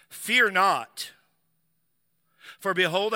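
loud, high call of an angel; proclaiming angel. Acted. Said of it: "And the angel said unto them".

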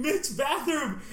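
loud, high urgent yell; retold scream. Screaming at Mitch to run for the bathroom, high yell. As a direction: yell him toward the bathroom. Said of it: "Like, I scream".

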